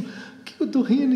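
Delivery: mock baffled cry through a laughing fit; retold helpless laughter. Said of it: "se contorcia de tanto rir".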